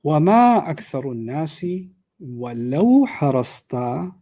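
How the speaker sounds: تلاوة مرتلة; Quran recitation. Shown as a demonstration. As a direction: chant the ayah in tajweed. Reciting Quran ayah in class.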